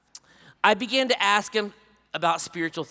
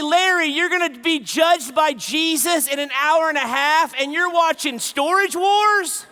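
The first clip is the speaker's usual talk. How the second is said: high, loud exasperated outburst, hammed-up; incredulous scolding; 9 semitones higher, 6 dB louder than usual. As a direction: shout the absurd accusation, stretching the end. Scolds dying man watching TV.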